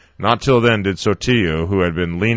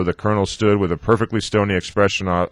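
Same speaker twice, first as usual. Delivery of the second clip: usual speaking voice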